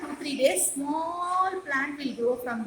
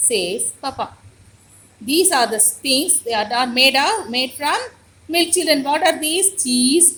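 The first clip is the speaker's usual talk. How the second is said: louder storybook reading for a class; teacher reading a picture book aloud, louder; reading to children; 8 dB louder than usual. Acted. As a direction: read the lesson aloud to little kids.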